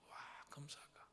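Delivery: hushed wonder; awed whisper. Acted. Preacher marveling at a golden apple, whispered. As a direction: breathe it in awe.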